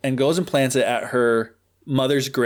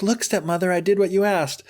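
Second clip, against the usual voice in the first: put-on higher, hopeful girl voice; eager Cinderella, higher; 6 semitones higher than usual. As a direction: Cinderella proudly telling stepmother she finished. Cinderella reports again.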